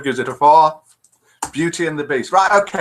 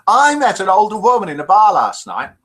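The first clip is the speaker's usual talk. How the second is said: loud, higher punchline setup; big joke opener; 6 semitones higher, 6 dB louder than usual. Shown as a demonstration. Host opening a joke to the chat room, loud and bright. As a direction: launch the joke with a flourish.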